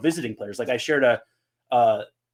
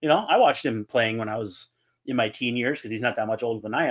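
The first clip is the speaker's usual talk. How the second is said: normal talking voice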